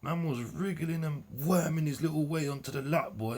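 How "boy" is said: normal talking voice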